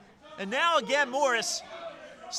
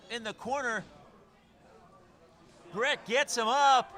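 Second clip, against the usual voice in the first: ordinary talk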